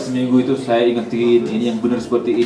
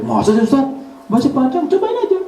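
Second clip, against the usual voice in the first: high, disbelieving student voice; imitating insistent student; 12 semitones higher than usual. Student insisting to the ustaz, high incredulous protest. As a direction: play the stubborn student, sure he's right.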